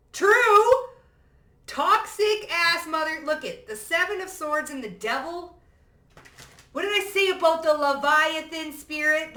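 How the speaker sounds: high, loud, fired-up tirade; excited rant. Real, not acted. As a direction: ride the excitement and hammer it.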